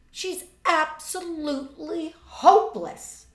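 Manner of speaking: loud, drawn-out verdict on a guest; Marjorie's voice. Acted. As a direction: sneer it out as the spoiled daughter. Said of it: "It was Marjorie's voice".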